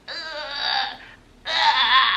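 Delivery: a real-sounding labour groan, high and strained; strained pushing groan. Retold or imitated. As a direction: grunt like bearing down. Retelling pushing in labour pain.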